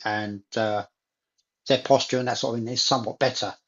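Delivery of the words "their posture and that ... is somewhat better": usual speaking voice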